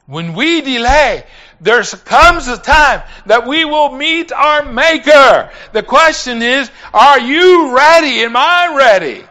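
high, loud exhortation; fervent sermon delivery; full-force preaching. Real, not acted. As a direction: challenge the congregation urgently.

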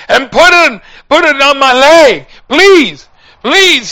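high, loud desperate plea; desperate begging. Retold or imitated. Dying man begging preacher for a cold washcloth, desperate shout. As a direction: cry out for help, begging.

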